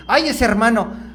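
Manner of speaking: grumbling about a church brother; mock complaint. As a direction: complain with a weary "ay". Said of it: "te estás quejando del hermano".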